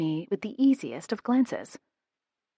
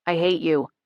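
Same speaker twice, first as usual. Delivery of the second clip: a grumbled, louder I hate you after choking; grumbling; 4 dB louder than usual. A narrator's reading. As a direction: growl it out with a sore throat. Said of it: "I grumble when I can finally move air".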